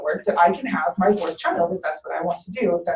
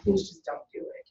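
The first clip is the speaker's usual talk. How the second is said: softly imitated begging; a hushed, understated plea, retold; quiet retold plea; 8 dB quieter than usual. Mom begging her daughter against tubal ligation, quietly retold plea. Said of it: "my mom begged me".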